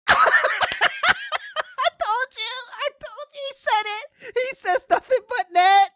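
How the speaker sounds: very high squeal through laughter, ending loud; gleeful shrieking. Real, not acted. Host crowing that the prediction came true, squealing with laughter. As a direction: crack up in high-pitched triumph.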